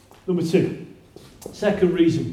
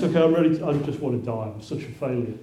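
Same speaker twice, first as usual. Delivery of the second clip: low, soft, defeated prophet pleading to die; a hint of hopeless despair, quiet; despairing Elijah; 5 semitones lower, 5 dB quieter, faster than usual. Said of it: "That's what he says".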